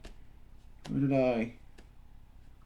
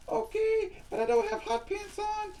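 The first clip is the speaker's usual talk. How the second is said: very high squeaky doll voice; high-pitched puppet Susie Q; squeaky girl puppet. Acted. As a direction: play a tiny girly doll. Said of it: "we'll call you Susie Q".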